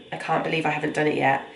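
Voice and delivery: ordinary talk, nothing marked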